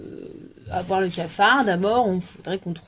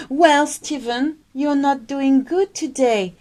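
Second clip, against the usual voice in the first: higher, louder, slow British voice asking after a friend; acted English dialogue; 6 semitones higher, 4 dB louder, slower than usual. Teacher acting a friend asking Stephen, British accent. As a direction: play a concerned Englishman, slow and high. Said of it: "mini English dialogue".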